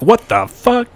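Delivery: startled outburst. Retold or imitated. Startled by earlobe grab, retold.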